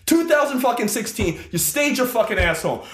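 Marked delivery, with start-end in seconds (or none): none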